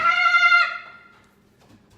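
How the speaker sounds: high, loud, slow mock lament; mock wail. Vlogger dramatically mourns losing her Greenville friends. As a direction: wail it like a tragedy.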